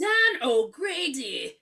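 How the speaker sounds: loud, slow, high mock-Irish; fake Irish accent. Host retrying the wife's line, fake Irish accent. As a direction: drag out a phony Irish accent loudly. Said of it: "I can't even do it".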